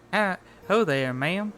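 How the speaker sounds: lightly voiced old farmer; a hint of a country farmer. Shown as a demonstration. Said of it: "we should do, like, a farmer voice".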